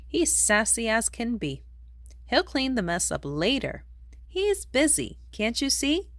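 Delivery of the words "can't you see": plain reading voice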